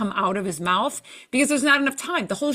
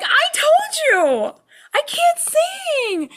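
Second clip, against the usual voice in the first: much higher, louder, slow whiny protest; exasperated mock wail; 5 dB louder, slower than usual. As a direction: wail it like a told-you-so complaint. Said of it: "And I'm like".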